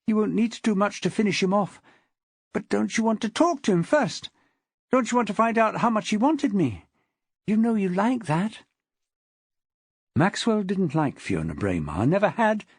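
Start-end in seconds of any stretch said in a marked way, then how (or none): 0.1-8.6 high-pitched woman's coaxing voice; hurried pleading. A narrator's reading. Wife talking her husband out of another blow, hurriedly pleading. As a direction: plead quickly to hold him off, coaxing. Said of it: "she hurriedly continued".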